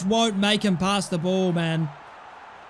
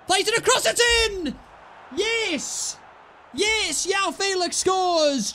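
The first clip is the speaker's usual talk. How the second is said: goal celebration; 9 semitones higher, slower than usual. Real, not acted. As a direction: explode as the winner goes in. Late goal to take lead.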